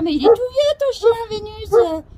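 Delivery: high, loud mocking viewer voice. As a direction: mimic a viewer nagging. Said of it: "vous allez me dire".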